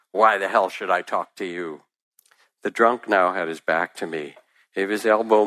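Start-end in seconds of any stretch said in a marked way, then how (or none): none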